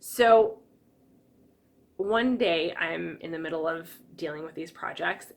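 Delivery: ordinary talk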